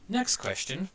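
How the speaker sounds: ordinary talk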